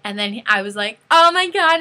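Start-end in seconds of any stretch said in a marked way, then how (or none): 1.1-1.8 high, loud burst of excitement; acted fangirl squeal. Fan the singer is smiling at, squealing. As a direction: squeal as if your idol noticed you.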